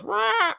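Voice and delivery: short high mock roar; boss roar. Boss in the game just roaring before the fight, high mock roar. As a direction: bark out a cartoon battle cry. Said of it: "he just goes".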